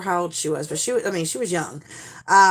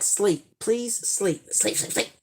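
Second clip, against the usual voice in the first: soft, half-whispered begging; weary pleading; 6 dB quieter, slower than usual. Retold or imitated. Tired mom silently begging the kids to sleep, breathy plea. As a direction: beg them to fall asleep, barely voiced.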